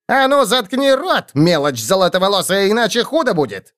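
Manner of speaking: high-pitched scolding threat; сердитая угроза. Acted. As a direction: warn the little pest off, voice pitched high. Гоблин угрожает обидчику. (сердито пригрозить)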